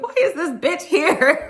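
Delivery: outraged at an intruder; mock outraged diva. Imitating Dorit's outburst.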